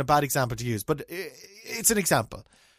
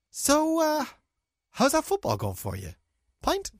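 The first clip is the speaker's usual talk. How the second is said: awkward imitation; 13 semitones higher than usual. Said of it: "show up in the pub and go".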